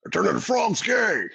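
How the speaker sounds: high ranting shout; Alex Jones impression. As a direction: yell it like a conspiracy radio host. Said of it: "an Alex Jones impression".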